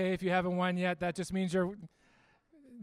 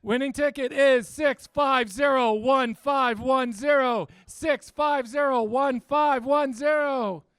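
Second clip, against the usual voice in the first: raffle caller; 3 semitones higher, 7 dB louder, slower than usual. Acted. Announces raffle numbers.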